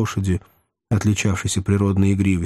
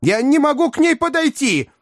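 very high, exasperated wail; crying out helplessly. A narrator's reading. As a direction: wail for help, pitched far up. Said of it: "крикнул мистер Уинкли". (отчаянный высокий крик)

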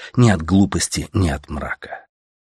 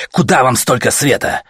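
higher-pitched, indignant demand; angry outburst; 6 semitones higher, 4 dB louder than usual. A narrator's reading. Artyom cursing as he smashes the panel, angry outburst. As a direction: throw the question out in anger.

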